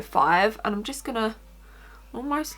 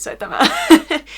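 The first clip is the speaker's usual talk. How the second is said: laughing; 9 semitones higher, 9 dB louder than usual. Real, not acted. Joking she can't buy it.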